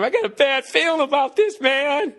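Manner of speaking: high, nervous movie soldier voice; scared soldier from Platoon. Retold or imitated. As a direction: quote the jittery grunt from Platoon. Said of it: "like that guy in Platoon".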